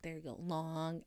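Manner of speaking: long Boston vowel in "long"; Boston accent. Shown as a demonstration. Podcaster showing her old hometown vowel, Boston accent. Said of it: "that's a very Boston thing".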